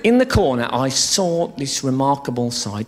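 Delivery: ordinary talk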